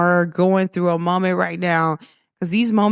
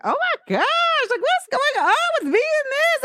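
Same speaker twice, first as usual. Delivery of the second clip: amazed exclamation. Retold or imitated. Amazed at people's reaction.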